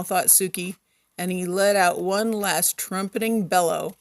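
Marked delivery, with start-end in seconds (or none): none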